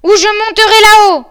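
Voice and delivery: high, very loud yell; shouted threat. A narrator's reading. Bluebeard threatens to climb up to his wife, shouting. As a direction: bellow it up the stairs. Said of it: "cria Barbe Bleue".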